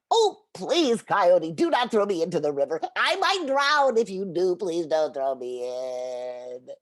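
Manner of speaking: beg, hamming up the fear; mock pleading. Turtle pretends to dread the river, hammed-up begging. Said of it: "Turtle cried".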